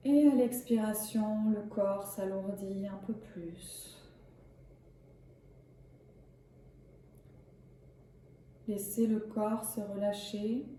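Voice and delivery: calm, measured yoga nidra guidance; unhurried relaxation voice with spacious pauses; guided meditation. Acted. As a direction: guide them gently into rest, leaving long pauses. Leads a yoga nidra session.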